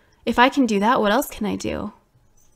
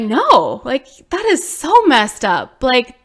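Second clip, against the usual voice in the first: higher and louder, insistent; retold indignant realization; 6 semitones higher, 6 dB louder than usual. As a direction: convince yourself you deserve it. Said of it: "I'm like".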